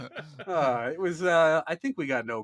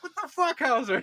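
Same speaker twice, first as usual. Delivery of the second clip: group disbelief; 10 semitones higher than usual. Retold or imitated. Group reacts to the gun.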